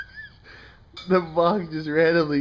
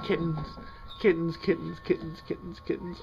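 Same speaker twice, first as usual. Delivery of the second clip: soft coaxing call; 8 dB quieter, faster than usual. Real, not acted. Calling kittens softly.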